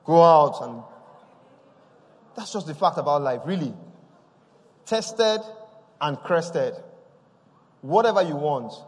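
preaching crescendo; rising preaching. Acted. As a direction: drive the refrain home with growing force. Preaching builds to full force.

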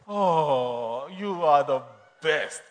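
low, slow, drawn-out swoon; adoring thanks. Acted. Wife thanking her man before hanging up, adoringly. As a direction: stretch it out in syrupy gratitude.

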